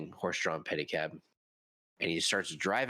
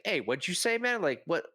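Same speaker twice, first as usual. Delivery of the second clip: mildly indignant, higher-pitched challenge; in-character confrontation; 8 semitones higher than usual. Retold or imitated. Offended player confronting a storyteller in town, in-character confrontation. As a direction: imitate someone picking a fight. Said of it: "Like, in character".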